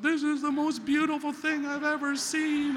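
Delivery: high, loud, slow blubbering sob-voice; mock bawling. Recalling bawling at a kitten commercial, mock blubbering high and slow. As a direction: wail it like a weepy drunk moved to tears. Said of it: "I'm bawling my eyes out".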